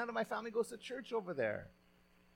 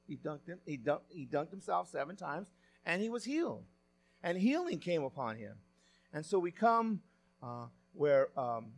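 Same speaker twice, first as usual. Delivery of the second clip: ordinary talk, nothing marked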